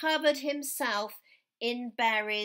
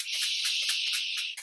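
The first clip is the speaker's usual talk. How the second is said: shaker shh. Shown as a demonstration. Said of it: "I'm gonna shake my shaker".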